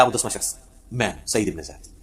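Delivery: hushed reveal. Retold or imitated. Companion admitting he is the tenth man, hushed and low. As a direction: drop right down for the quiet admission.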